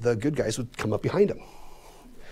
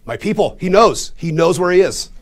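excited; 6 semitones higher, 9 dB louder than usual. Retold or imitated. Excited discovery of Project Yehudi.

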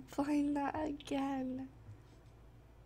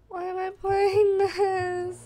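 scared whimper; 6 semitones higher, 7 dB louder, slower than usual. Real, not acted. Scared player whines mid-game.

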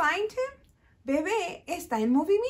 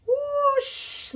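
whoosh; 9 semitones higher than usual. Shown as a demonstration. Airplane sound for kids.

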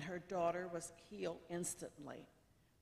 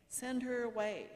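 higher, louder plea to dismiss her; urging; 6 semitones higher, 6 dB louder than usual. A narrator's reading. Disciples urging Jesus to dismiss a woman, insistent. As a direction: urge it firmly, pitched up. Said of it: "came and urged him".

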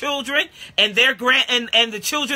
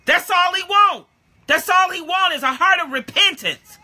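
high, raised exclamation; excited proclaiming; 7 semitones higher than usual. Real, not acted. Preacher telling the church what God wants, high and excited. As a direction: ring it out with joy.

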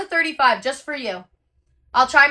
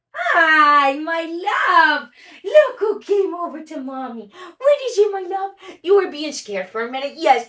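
gushing over the dog; dog talk; 5 semitones higher than usual. Real, not acted. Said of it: "I talk dog to my dog".

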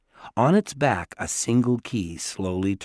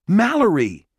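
high, loud cry of alarm; shocked cry; 10 semitones higher, 6 dB louder than usual. A narrator's reading. Jared seeing his sister in a glass case, alarmed cry. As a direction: cry out at the sight of her.

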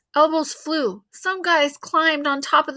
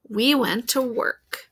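plain reading voice

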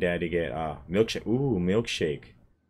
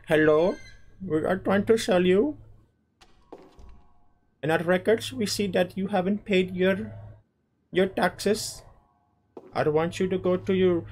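pushy phone scammer, pitched way up; telemarketer impression; 12 semitones higher than usual. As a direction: put on a high scam-caller voice. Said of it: "some bloody telemarketing call".

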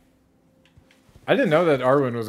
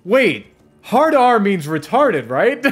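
much higher, slow dawning confusion; mock naive Linus; 10 semitones higher, 8 dB louder, much slower than usual. Imitating Linus, slowly checking what hard R means. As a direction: play him slowly realising his mistake.

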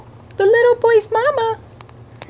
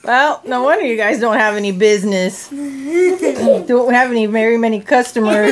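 deep puppet voice; 12 semitones lower than usual. Pretend. Puppet shopkeeper teasing the visitor, low and gruff. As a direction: drop way down and tease like a grumpy puppet.

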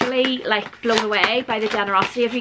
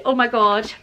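ordinary talk

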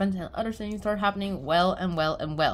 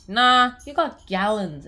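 put-on pushy girl voice; 3 semitones higher, 8 dB louder, slower than usual. Girl pushing loudly.

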